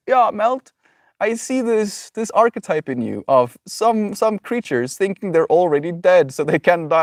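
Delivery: high, lilting put-on voice for a quote; imitated friend. Friend telling speaker about the archetype they see, imitated in a high voice. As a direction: voice the friend's reply well above your own pitch. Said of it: "then you were like".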